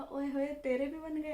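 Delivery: playful mock cheer; teasing delight. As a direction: tease her like a nosy older neighbour. Neighbour teasing her about fiance.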